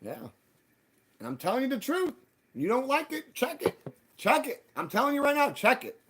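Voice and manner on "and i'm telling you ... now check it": very high, pleading insistence; high-pitched, emphatic dare; insistent urging. Real, not acted. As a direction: push them to go check, voice pitched way up. Urges listeners to check for themselves.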